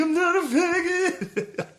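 high, slow sung-out protest; singsong whine. Retold or imitated. Teen whining back at his father, singsong. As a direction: sing it back in a whiny lilt.

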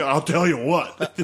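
put-on slow, laughing old-man voice; imitating dad. Imitating his drunk dad, slow gruff voice. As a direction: drawl it slowly like a riled-up dad. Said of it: "We'll be".

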